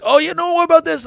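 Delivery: very high, loud, choosy whine; demanding. Acted. Ungrateful buddy demanding another video, high and whiny. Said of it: "a little demanding".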